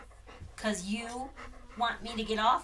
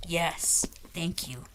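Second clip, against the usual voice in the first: usual speaking voice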